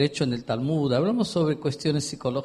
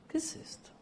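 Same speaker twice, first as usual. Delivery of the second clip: breathy whisper, almost to himself; a whispered rhetorical "¿Qué pasa?"; whispered question; 13 dB quieter than usual. Acted. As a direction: drop to a hushed whisper. Preacher whispers in disbelief.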